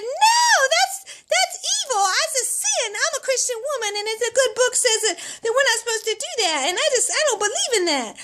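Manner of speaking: high-pitched, hammed-up objection; horrified protest. Mother objects to pediatrician's bottle order, high horrified protest. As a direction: shoot up high in mock outrage.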